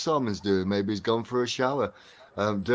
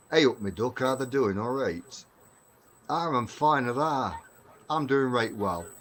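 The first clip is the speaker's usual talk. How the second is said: higher, louder, broad Derbyshire lad; broad Derbyshire dialect; 4 semitones higher, 5 dB louder than usual. Shown as a demonstration. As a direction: give it thick North of England Derbyshire dialect. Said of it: "a small example of how I used to talk".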